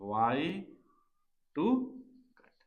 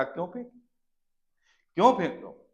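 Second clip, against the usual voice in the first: mild protest; 5 semitones higher, 4 dB louder, faster than usual. Acted. Acted protest at thrower.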